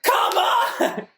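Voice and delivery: very high holler breaking into laughter; mock an overexcited churchgoer, laughing; mock congregation yell. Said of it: "And they go".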